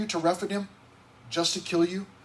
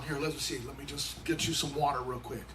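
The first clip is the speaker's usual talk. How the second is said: softer, lower fake-obliging reply; mock soothing; 4 semitones lower, 8 dB quieter than usual. Pastor pretending to fetch water for the crowd, soft mock obliging. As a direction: play along quietly. Pastor imagines answering, softly.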